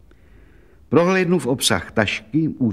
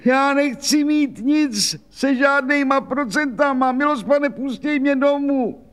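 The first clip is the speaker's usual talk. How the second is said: put-on much higher, keening complaint of an old washerwoman; wailing lament; 14 semitones higher than usual. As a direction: wail out her hard life, high and plaintive. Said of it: "brečela dál Anna Buklová".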